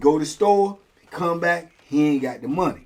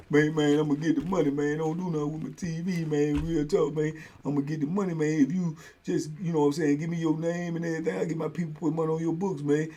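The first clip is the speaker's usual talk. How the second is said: soft pleading debtor; 8 dB quieter than usual. Retold or imitated. Debtor pleading to get TV back.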